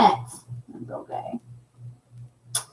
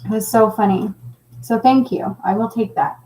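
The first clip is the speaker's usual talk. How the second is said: ordinary talk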